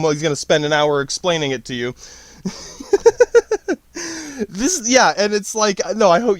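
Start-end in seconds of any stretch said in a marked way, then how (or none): 2.8-3.7 sudden high cackle; high laugh burst. Real, not acted.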